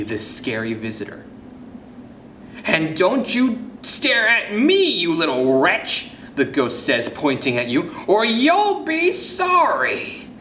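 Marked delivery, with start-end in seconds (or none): 2.7-6.1 slow, raised-pitch ghostly threat; haughty storybook ghost's scolding; menacing ghost. Acted. Ghost woman threatening the child. 8.1-10.3 very high, drawn-out spooky threat; high, slow ghostly wail; menacing ghost. Acted. Ghost woman threatening the child.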